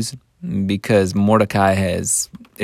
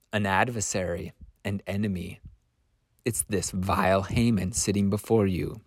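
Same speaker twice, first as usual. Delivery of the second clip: very soft, measured accusation; name the enemy quietly, with pauses; low-volume, grave reveal; hushed accusation; 3 semitones lower, 10 dB quieter than usual. Acted. Esther quietly names her enemy.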